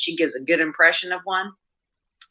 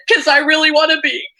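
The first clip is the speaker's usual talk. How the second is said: high, tearful mock voice of the sister, louder; imitating crying sister; 13 semitones higher, 7 dB louder than usual. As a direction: pitch way up like she's weeping on the phone. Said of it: "and she was crying".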